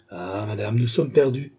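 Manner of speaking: usual speaking voice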